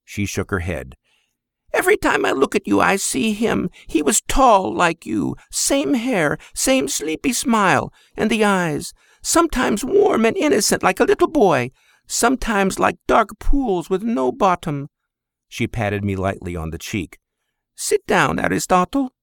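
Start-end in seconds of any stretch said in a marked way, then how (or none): 1.7-14.9 high, tender mother's voice; fond Greek mother. Acted. Mother fondly compares her son to his great-grandfather. 17.7-19.1 high, tender mother's voice; fond Greek mother. Acted. Mother fondly compares her son to his great-grandfather.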